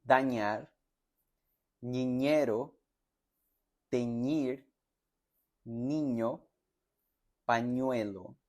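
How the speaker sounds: words with a clear ny sound for ñ; ñ drill words; Spanish ñ. Shown as a demonstration. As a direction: model "dañar" to "pañuelo" one by one. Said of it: "Vean los ejemplos".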